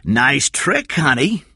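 loud, mocking praise, then slow order; taunt, then command deliberately; menacing Cy. Cy caught Kathy, mocking menace.

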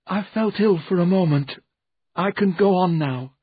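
high-pitched man recovering from a fright; shaken character voice. Acted. Shaken Jerome excuses himself.

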